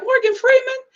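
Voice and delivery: high, incredulous question; mock astonishment. Reacting to dad's famous-sounding voice, astonished. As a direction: blurt it high as if you spotted a star.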